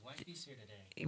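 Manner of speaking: "Wifey's here today" whispered under the mic; barely audible whisper. Real, not acted. Host greets his wife in a bare whisper. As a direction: breathe it off mic. Near-silent first try.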